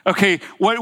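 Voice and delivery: ordinary delivery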